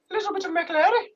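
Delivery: squeaky Irish leprechaun; very high Irish lilt, rising. Pretend. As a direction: chirp a question like an Irish sprite. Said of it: "I think of leprechauns".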